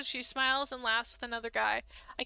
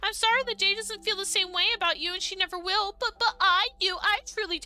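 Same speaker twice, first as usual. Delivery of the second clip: much higher tender confession; confessing girl; 8 semitones higher, 4 dB louder than usual. Acted. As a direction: confess love shyly and high.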